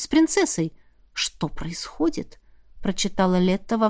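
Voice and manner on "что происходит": hushed and puzzled, slow; quiet inner question, slowly; soft puzzled whisper. A narrator's reading. Silent puzzled glance read.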